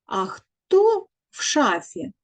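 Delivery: ordinary talk, nothing marked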